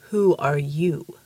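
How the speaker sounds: very slow, low giant voice; mountain giant. A narrator's reading. The giant questioning the tiny detective, slow deep voice. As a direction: drag it out deep, like a living mountain.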